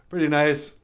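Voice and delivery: ordinary talk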